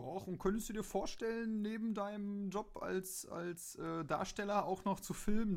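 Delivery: high, mock-innocent questioning voice; innocent Frank. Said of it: "wird er so unschuldig dargestellt". (hoch und gespielt unschuldig)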